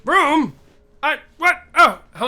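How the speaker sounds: usual speaking voice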